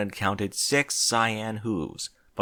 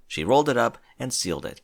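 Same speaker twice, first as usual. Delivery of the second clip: usual speaking voice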